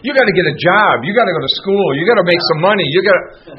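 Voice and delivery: family lecturing the newcomer to get a job; nagging family. Retold or imitated. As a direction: hammer each you gotta at him.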